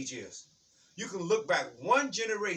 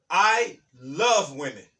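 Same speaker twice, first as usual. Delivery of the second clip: slow loud declaration; 2 semitones lower, 9 dB louder, much slower than usual. Real, not acted. Preacher booms a declaration.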